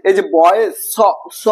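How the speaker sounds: বয়েস ending on a long hissed স; hissing s sound. Shown as a demonstration. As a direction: stretch the স in বয়েস into a hiss. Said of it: "যে আসছে, সেটা হচ্ছে কি".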